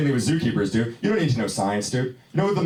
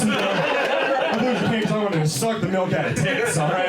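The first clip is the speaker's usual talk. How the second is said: pitched way up, whining about being scammed; high indignant whine; 8 semitones higher than usual. Pretend. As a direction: complain like a cheated tourist, voice up high. Comic rant about the scam.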